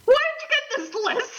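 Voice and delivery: high shouted line through laughter; laughing shout. Real, not acted. Laughing at cohost's strange slang list, shouting. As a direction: burst out laughing at her.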